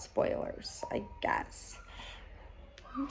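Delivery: whispering the word spoilers; whispered aside. Real, not acted. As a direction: drop to a sheepish whisper.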